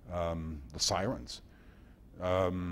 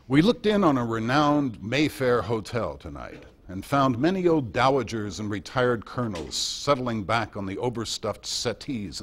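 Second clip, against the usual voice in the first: louder, higher broadcast read with a wry edge; reading his favorite passage; 5 semitones higher, 7 dB louder than usual. Retold or imitated. Sharing his favorite Murrow passage, louder and amused. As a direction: relish the irony like a radio correspondent. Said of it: "But here's my favorite".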